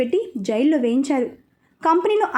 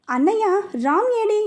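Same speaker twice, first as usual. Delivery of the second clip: worried question, pitched higher; frightened sister calling for her brother; anxious; 6 semitones higher than usual. A narrator's reading. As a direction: ask in a rising panic for the missing child. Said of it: "కంగారుగా".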